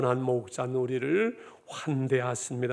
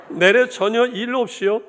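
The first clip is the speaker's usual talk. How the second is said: much higher, louder Hamgyeong dialect, 내래 stressed, no laugh; 7 semitones higher, 7 dB louder than usual. Retold or imitated. Pastor repeats the punchline to the church in a loud Hamgyeong dialect. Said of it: "함경도 버전 하나 더 할까요".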